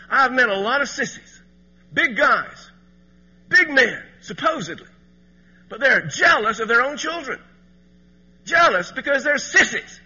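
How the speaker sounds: loud, high preaching to the men; preaching at full force. Acted. Preacher scolding jealous fathers at full force.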